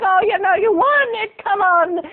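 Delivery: high-pitched Irish cajoling; Irish neighbor. Acted. Irish neighbor pressing cola on boy, Irish lilt. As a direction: egg him on with an Irish lilt. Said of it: "That's her Irish".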